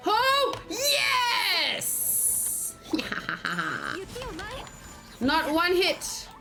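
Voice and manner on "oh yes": ordinary talk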